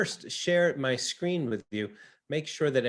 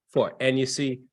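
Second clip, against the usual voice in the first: ordinary talk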